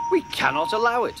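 high, quick protest; indignant. A narrator's reading. The engines agree to stand against Duck, indignant. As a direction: declare it in outrage, pitched right up.

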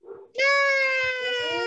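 high, long drawn-out cheer; delighted cheer. Real, not acted. Teacher praises Christopher for reading it, cheering. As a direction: celebrate with a stretched, high-pitched shout of praise.

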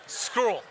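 "Scroll" in place of squirrel, one syllable; mispronounced squirrel. Shown as a demonstration. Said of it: "certain words I just can't say".

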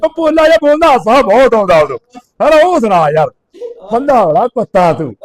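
put-on loud, high, rowdy drunk ribbing; drunk rant. Drunk friend on the phone rants loudly at the narrator. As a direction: hammer the insults like a merry drunk. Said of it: "ਨਸ਼ਾ ਪੱਤਾ ਕਰਕੇ ਟੈਟ ਹੋਇਆ ਫਿਰਦਾ".

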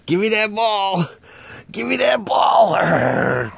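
high, drawn-out playful yell; mock demanding shout. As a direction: tease the dog with a loud, stretched-out demand. Mock fight with dog over ball.

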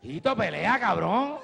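loud, rising shout at the TV; mock yell at the screen; fan yelling. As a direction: urge the boxer on, frustrated and laughing. Fans yelling at the TV.

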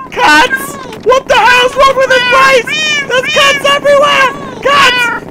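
very high, loud hammed-up terror, building into screams; panicked screaming. Player finds cats everywhere, panicked screaming. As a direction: shriek at the top of your range.

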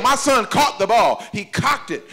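ordinary talk, nothing marked

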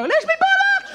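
squeaky high cartoon dog, laughing. Comic playing a dog fetching a ball, squeaky high voice. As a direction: yelp it like an excited dog. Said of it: "a dog will go".

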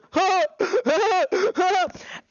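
wild high cackle; manic high laugh. Retold or imitated. Under the table playing up, a wild high cackle. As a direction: cackle like a maniac. Lifting table, going mad.

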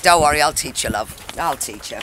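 low Aussie promise, trailing off; Australian accent. Acted. Aunt promising to teach her cousin the Australian accent. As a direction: reassure her in a broad Australian voice. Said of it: "I love your exotic accent".

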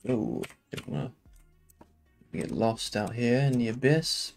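ordinary talk

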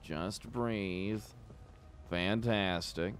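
slow, soft breathing coach; calming. Pretend. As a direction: talk them down slowly and softly. Guard coaching an angry man to breathe.

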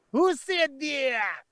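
put-on high, drawn-out Austrian-sounding accent; Arnold Schwarzenegger. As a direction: stretch the quiz question out in a mock Austrian accent. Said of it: "It sounds like Arnold Schwarzenegger".

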